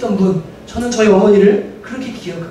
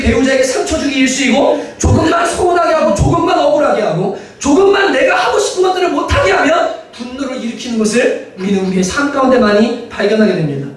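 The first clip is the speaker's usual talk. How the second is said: ordinary talk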